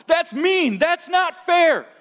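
very high, loud, drawn-out whine; hammed-up tantrum protest; childish objectors. People hearing God on hell, childish whining protest. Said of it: "They hear God talking about hell".

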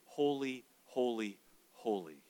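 a whispered-down triple holy; hushed reverent holy. Shown as a demonstration. Quoting the angels' phrase reverently.

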